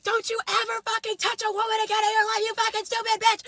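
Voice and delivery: high-pitched, fast angry tirade; retold fury. Young woman telling off the man she fought, so mad. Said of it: "Like, so mad".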